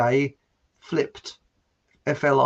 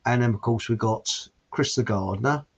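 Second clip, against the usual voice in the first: ordinary delivery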